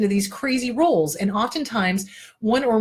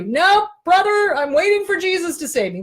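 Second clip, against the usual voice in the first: 1 stubborn refusal; 9 semitones higher, 4 dB louder than usual. Acted. Drowning man refusing the helicopter, high and loud. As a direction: dig in, cheerful refusal to be saved.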